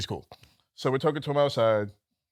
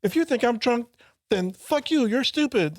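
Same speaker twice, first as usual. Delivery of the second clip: put-on higher, indignant drunk retort; drunk regular's voice; 10 semitones higher than usual. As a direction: snap back like the offended drunk. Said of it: "his voice gets all high".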